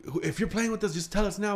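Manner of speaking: scared calling out. Retold or imitated. Boys calling through the door to the intruder, scared high plea. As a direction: cry out through the door, frightened.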